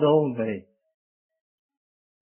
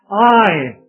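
"I" punched higher and louder; a strong, raised "I" standing alone; stress on I; 4 semitones higher, 7 dB louder than usual. Shown as a demonstration. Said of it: "He's emphasizing himself".

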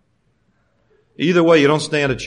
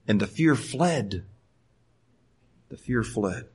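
much softer, slow, letting the words sink in; hushed repeat; 6 semitones lower, 9 dB quieter, slower than usual. Real, not acted. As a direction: drop to a near hush on fled. Repeated softly for emphasis.

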